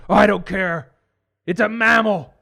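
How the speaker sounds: angry father. Acted. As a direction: snobbish dad demanding help. Father yelling.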